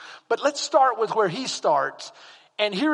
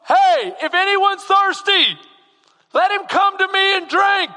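much higher and louder, shouting to a crowd; calling out to a crowd; 11 semitones higher, 9 dB louder than usual. Pretend. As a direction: call out across a busy feast. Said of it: "Someone stands up and goes".

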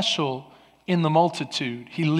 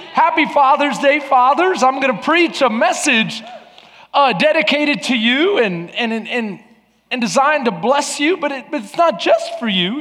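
high, loud preacher's call to the congregation; full-force preaching; 6 semitones higher, 7 dB louder than usual. Acted. Pastor urging the congregation, full-force preaching. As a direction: preach it out to the whole room. Preaching Father's Day message.

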